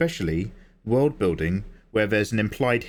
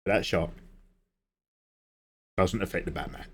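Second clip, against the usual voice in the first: butler to Batman; 2 semitones lower than usual. Acted. Butler warning Bruce about a psychic shark, mock-grave. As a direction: address the master gravely, with pauses.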